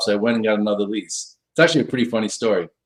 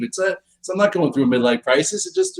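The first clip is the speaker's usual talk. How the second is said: ordinary talk, nothing marked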